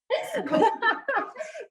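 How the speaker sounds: high helpless giggle; real giggling fit. Real, not acted. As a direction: burst into high-pitched laughter. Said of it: "This is so funny".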